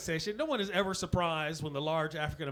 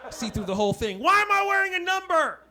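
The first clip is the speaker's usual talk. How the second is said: tripping runner; 6 semitones higher, 5 dB louder than usual. Acted. Tripping marathon runner voiced.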